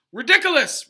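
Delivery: high, loud roar; teacher roaring the spell at the boggart. A narrator's reading. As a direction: roar the word to banish it. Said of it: "roared Professor Lupin".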